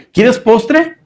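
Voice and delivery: exaggerated fake-nice dad; mock sweet father. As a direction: tease like an overly kind dad. Father's offer, child's view.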